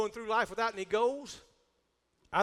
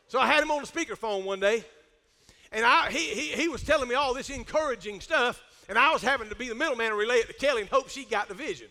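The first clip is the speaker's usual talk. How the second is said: usual speaking voice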